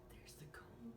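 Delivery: soft whisper. Acted. Pointing out the comb, whispered.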